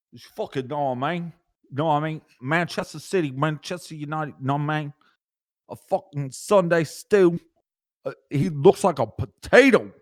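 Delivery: brash Manchester voice; mock Manchester accent. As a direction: play the cheeky Manc bloke. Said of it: "giving me shit about my accent".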